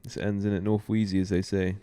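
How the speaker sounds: a light mock London street accent; put-on London roadman slang. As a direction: greet him in a hammed-up London roadman way. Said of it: "you sounded completely different".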